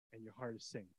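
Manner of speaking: ordinary talk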